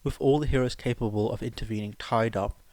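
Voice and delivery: plain reading voice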